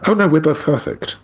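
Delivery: facetious. Real, not acted. Joking to interviewer that both are flawless, facetious. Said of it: "sorry, that was facetious".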